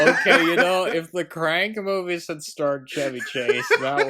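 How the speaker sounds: talking through real laughter, voice up high; barely holding it together while joking; laughing. Real, not acted. Laughing to her co-host about a Chevy Chase Crank film.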